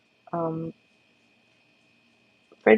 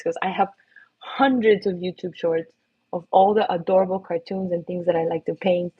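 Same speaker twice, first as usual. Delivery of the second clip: normal talking voice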